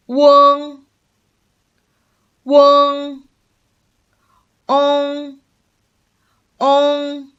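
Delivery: high, level, sustained nasal syllables; nasal finals. Shown as a demonstration. Teacher models nasal finals for learners, high level nasal tone. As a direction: hold each final on one high note, through the nose. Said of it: "it will involve your nose to pronounce".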